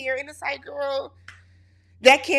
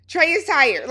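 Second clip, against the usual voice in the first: "Trey is tired" said higher and louder, insisting; louder repeat; 6 semitones higher, 6 dB louder than usual. Real, not acted. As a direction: raise it, amused.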